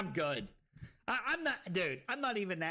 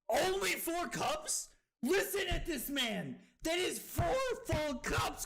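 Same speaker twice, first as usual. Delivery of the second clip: incredulous; 8 semitones higher than usual. Real, not acted. Incredulous at another player.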